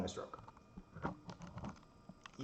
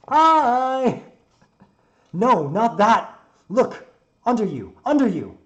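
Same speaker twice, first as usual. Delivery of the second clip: high, full-volume shouting; yelled warning; 12 semitones higher, 17 dB louder, much slower than usual. Acted. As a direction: yell urgently to someone out of earshot. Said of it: "Doesn't he understand what I'm yelling at?".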